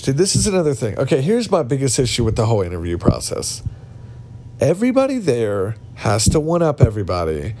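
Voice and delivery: usual speaking voice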